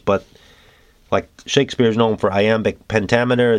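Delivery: ordinary delivery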